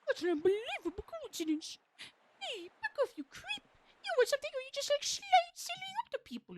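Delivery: squealing high diva pig; Miss Piggy voice. Acted. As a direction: shriek it like an outraged puppet. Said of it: "I just only know it's like".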